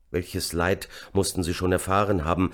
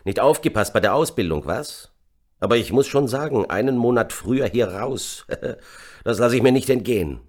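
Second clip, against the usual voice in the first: grinning; 5 semitones higher, 4 dB louder than usual. A narrator's reading. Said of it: "Rock grinste".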